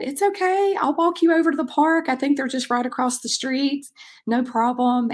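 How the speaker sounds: put-on calm the scared little boy, lifted way up; reassuring a child. Coach to a crying boy looking for his dad, high and soothing.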